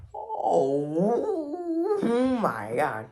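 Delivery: long high wailed "oh" falling away; exasperated disbelief. Real, not acted. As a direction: draw out a despairing, rising "oh". Overwhelmed by absurd robot claim.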